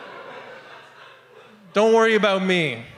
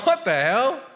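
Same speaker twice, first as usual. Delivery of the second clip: retold indignant disbelief; 6 semitones higher than usual. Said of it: "So I'm like".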